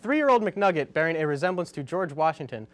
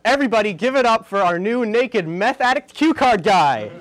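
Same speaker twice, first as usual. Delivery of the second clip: loud, raised-pitch call for applause; rousing introduction; 5 semitones higher, 8 dB louder than usual. Pretend. Host introduces his new cue card guy, rousing shout. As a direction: whip the crowd up to welcome someone.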